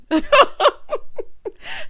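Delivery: high, loud self-mocking laugh; real laughter. Real, not acted.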